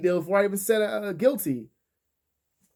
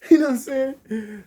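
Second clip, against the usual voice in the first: high laugh through words; 11 semitones higher, 9 dB louder than usual. Real, not acted. Laughing punchline.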